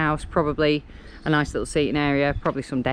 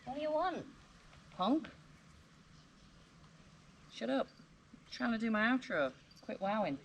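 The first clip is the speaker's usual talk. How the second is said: higher, mock-tough telling-off; gruff; 6 semitones higher, 12 dB quieter than usual. Real, not acted. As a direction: playfully scold the barking dog. Scolding the dog during outro.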